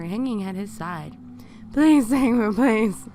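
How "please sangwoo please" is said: acted urgent begging for mercy; desperate begging. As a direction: beg him loudly, pitched up. Captive begs captor with sledgehammer.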